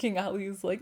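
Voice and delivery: ordinary delivery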